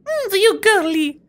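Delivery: high slow mock Russian woman; female Russian accent. Shown as a demonstration. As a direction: stretch it out high in a Russian lady voice. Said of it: "Russian female is hard to do".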